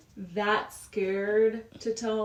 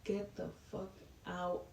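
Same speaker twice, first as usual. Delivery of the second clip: quiet anger; 10 dB quieter than usual. Retold or imitated. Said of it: "with so much anger in his eyes".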